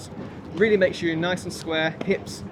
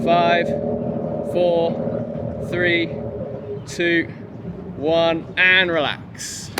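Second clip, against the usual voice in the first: loud countdown; 3 dB louder, much slower than usual. Real, not acted. Coach counting down final seconds.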